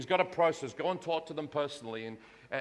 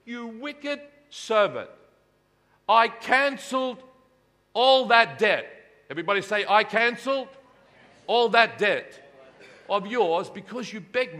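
angry master; 6 semitones higher, 8 dB louder than usual. Acted. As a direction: lay into the wicked servant, word by word. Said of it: "He said".

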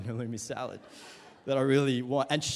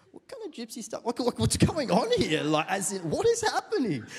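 retold indignant outburst, higher; mock upset; 7 semitones higher, 3 dB louder than usual. Pastor acts out his reaction to the salad, mock upset. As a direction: act out a hammed-up, baffled protest. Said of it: "And I got really upset".

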